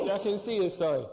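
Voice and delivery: loud, high, laughing. Real, not acted. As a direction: giggle through it. Giggling on stage.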